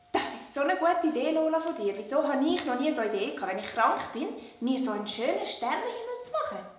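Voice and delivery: ordinary talk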